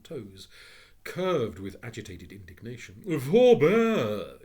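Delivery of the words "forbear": much louder, grand outcry; phoenix cry. Acted. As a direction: forbid the porter. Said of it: "it cried".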